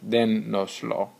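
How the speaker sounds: Dénoslo, slowly, stressed on DÉ; teacher's slow model of Dénoslo, DÉ stressed; accented-syllable stress. Shown as a demonstration. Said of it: "maintain the proper pronunciation of the conjugation".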